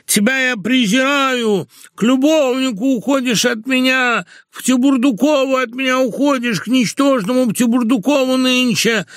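high, declamatory verse lament; drawn-out whining tone. A narrator's reading. Betrayed husband laments in iambic pentameter, whining. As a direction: chant the reproaches in a high wailing metre. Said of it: "продолжал Лоханкин в том же тягучем тоне".

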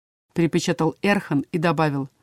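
ordinary delivery, nothing marked

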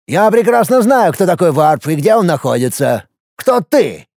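a wary minister pressing him; frowning minister. Acted. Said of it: "Нахмурился министр".

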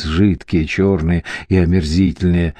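usual speaking voice

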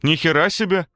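high loud amazed shout; loud exclamation. A narrator's reading. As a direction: exclaim it loudly. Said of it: "громко воскликнул голос сзади".

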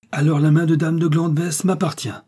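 high, loud, triumphant claim; joyful cry. A narrator's reading. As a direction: proclaim it with noble hope. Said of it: "s'écrie Guillaume, heureux de".

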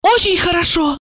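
high, agitated woman's voice; nervous tipsy relief; feverish muttering. A narrator's reading. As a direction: babble it feverishly, pitched high. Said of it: "лихорадочно забормотала Ольга".

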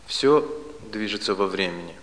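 ordinary delivery

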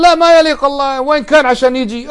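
high, scoffing voice of a doubter; mocking the objector. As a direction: ape the objector's scornful tone. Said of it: "يقول".

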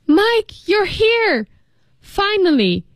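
high, drawn-out cry of relief; desperate exclamation. A narrator's reading. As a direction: exclaim as if rescued at last. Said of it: "she exclaimed desperately".

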